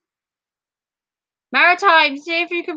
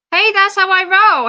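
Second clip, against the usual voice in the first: higher, louder playful brag; excited boast; 6 semitones higher, 5 dB louder than usual. Real, not acted. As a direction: show off, pleased with yourself. Playful boast while streaming.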